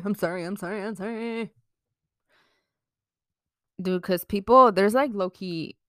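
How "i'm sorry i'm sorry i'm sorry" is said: mock apology.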